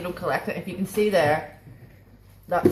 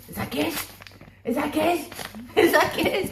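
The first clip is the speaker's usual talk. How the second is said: higher, gentle coo to the pet; light dog-directed voice; 7 semitones higher than usual. Real, not acted. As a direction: ask the puppy sweetly. Asking the dog about treat.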